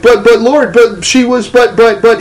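high, stammering reenacted protest; flustered protest. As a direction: stumble over your words trying to explain. Flustered protest to God's rebuke.

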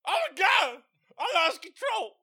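high yelling mock panic; loud panicked wail. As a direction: wail it like you lost it. Acted panic quote, laughing.

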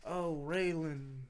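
put-on slow, lightly seductive Southern accent; a hint of a slow Southern drawl, soft. Friend imitating Justified's slow Southern talk, seductive. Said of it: "You seductively looked at me when you started talking".

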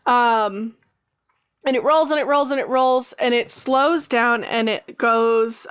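normal talking voice

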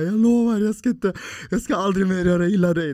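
high, loud tearful promise; crying plea. Retold or imitated. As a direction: sob it out like a small child begging. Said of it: "jag gråter och jag snorar". (gråtande, högt och bönfallande löfte)

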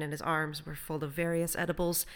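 ordinary delivery, nothing marked

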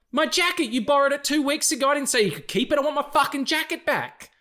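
high, loud, demanding; unhinged indignant rant. Retold or imitated. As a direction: rant about the jacket like a madman. Retold fake insane rant.

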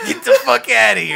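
high, loud mock Boston yell; shouted Boston accent, an alien driving off colonizers. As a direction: bellow it like an angry Boston local. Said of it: "they're all talking in a Boston accent".

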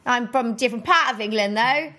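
hammed-up English accent; put-on English accent. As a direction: show off an English regional accent. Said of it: "I do pretty good English accents".

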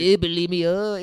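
hammed-up French accent; mock French accent. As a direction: say it like a caricature French speaker. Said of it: "it sounds like a French disease".

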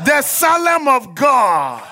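high, shouted declaration of faith; full-force preaching. Real, not acted. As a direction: proclaim it loudly for the congregation.

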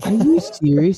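high, incredulous question; real disbelief. Real, not acted. Listener hearing of a bashed nose, real disbelief. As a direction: burst out in astonished disbelief.

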